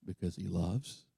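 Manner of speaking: hushed. Real, not acted. Quiet climax of the point.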